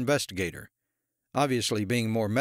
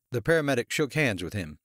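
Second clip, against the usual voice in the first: usual speaking voice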